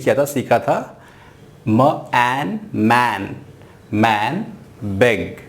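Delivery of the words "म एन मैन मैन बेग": slow, stretched मैन and बेग; slow sounding out. Shown as a demonstration. Teacher slowly sounds out मैन बेग for learners. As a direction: spell out each sound slowly. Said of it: "बैग नहीं कहना है".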